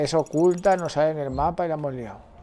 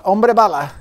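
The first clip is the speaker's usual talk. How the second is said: proud, louder bragging; loud boast; 4 semitones higher, 9 dB louder than usual. Real, not acted. Gamer bragging to viewers after the stunt, loud. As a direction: crow about it loudly.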